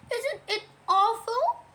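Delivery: high, tearful-sounding squeak; high-pitched fearful question; horrified Piglet. Acted. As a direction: ask it in small, high dread. Frightened Piglet clings to Christopher Robin.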